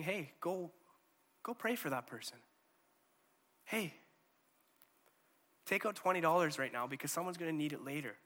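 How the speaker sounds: gentle inner voice urging you to give. Acted. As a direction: ease it out as a quiet nudge. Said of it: "this gentle voice".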